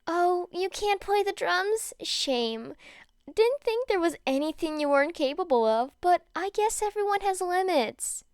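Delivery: bait him sweetly; sing-song mocking; coy taunting. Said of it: "smiled coyly".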